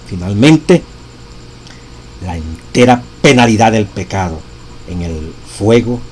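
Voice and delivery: preacher proclaiming the text; solemn reading aloud. Acted. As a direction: read each phrase with gravity. Continues reading the quote.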